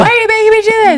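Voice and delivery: very high, loud and fast whine; hammed-up shrieking complaint; mock whiny protest. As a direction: squeal it like an exasperated client. S2 joining in the client voice.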